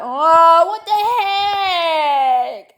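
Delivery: slow high crying shout; drawn-out wail. Real, not acted. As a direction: wail it out, stretched long.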